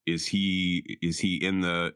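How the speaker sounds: ordinary talk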